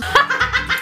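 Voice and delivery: sudden high loud laugh; burst of laughter. Real, not acted. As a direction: crack up loudly. Tasting a gummy bear eye.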